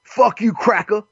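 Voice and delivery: hammed-up impatient drive-thru worker; mock rude cashier.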